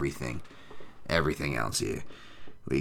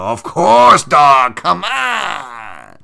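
much higher and louder, half-crying Come on; hyped-up cry; 8 semitones higher, 11 dB louder, much slower than usual. Real, not acted. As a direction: yell it like a celebrating fan. Excited hype to chat.

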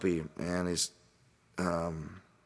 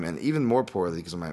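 normal talking voice

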